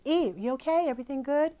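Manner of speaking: calling through a door. Retold or imitated. Knock on the door and say.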